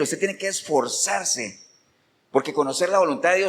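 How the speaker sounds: normal talking voice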